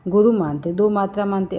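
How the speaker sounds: usual speaking voice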